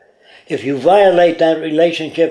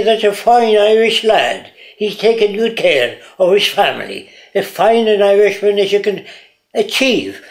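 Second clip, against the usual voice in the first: higher-pitched mock Irish accent; 5 semitones higher than usual. Speaker mimicking a baby raised in Ireland, Irish accent. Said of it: "If you're raised in Ireland".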